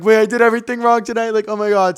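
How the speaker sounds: high, loud panicked mock outburst; a hammed-up freak-out about fans piling on; retold panic. Retelling panic over Swifties' attacks.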